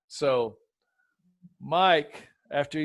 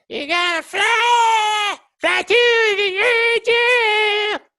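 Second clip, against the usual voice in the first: shrill-high mock rock singing, much higher and louder; mocking high falsetto; 15 dB louder, much slower than usual. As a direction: belt it in a strained, way-too-high falsetto. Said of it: "[name]'s voice live is so freaking annoying".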